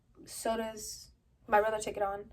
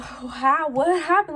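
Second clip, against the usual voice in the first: alarmed retold shout; 5 semitones higher, 11 dB louder, slower than usual. Frantic call to crying brother.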